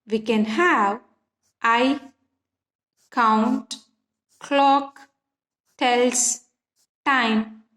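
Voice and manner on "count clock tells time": each word alone, slow and separated; stressed syllables. Shown as a demonstration. Teacher demonstrates the stressed beats to students, word by word. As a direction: tap out count, clock, tells, time one by one. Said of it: "follows by stressed syllable".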